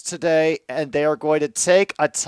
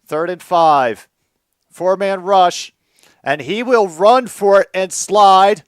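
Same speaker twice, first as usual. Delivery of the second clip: tense call; 3 semitones higher, 8 dB louder, slower than usual. Real, not acted.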